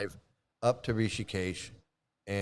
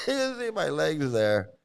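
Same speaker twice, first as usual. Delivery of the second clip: real laugh breaking into the words; higher, amused, talking through a laugh; 6 semitones higher than usual. Real, not acted. Showing listeners a photo of their bare legs, laughing.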